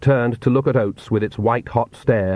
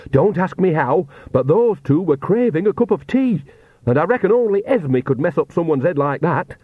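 higher, breathless gabble of news; out of breath; 6 semitones higher than usual. A narrator's reading. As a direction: pant it out after running from vampires. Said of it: "she panted".

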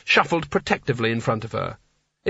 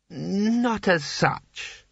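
higher, very slow vague admission; tipsy Seraph admitting; 6 semitones higher, much slower than usual. A narrator's reading. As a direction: drag it out sheepishly.

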